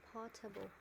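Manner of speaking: "portable" modelled as PORT-uh-bul; "portable" stressed on PORT, falling away; correct PORTABLE pronunciation. Shown as a demonstration. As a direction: say "portable" with a reduced, unstressed middle. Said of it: "it's actually".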